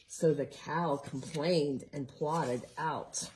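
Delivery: ordinary delivery, nothing marked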